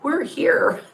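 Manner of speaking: high, slow little-chick voice; playful high baby-chick voice, drawn out. Pretend. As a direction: coo it like a tiny chick greeting you. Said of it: "all those little chicks are gonna say".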